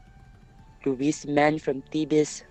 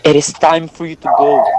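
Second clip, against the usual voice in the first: loud, lower-pitched dismissal; firm command; 4 semitones lower, 12 dB louder than usual. Acted. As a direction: send him away forcefully. Dismissing the blinded Oedipus.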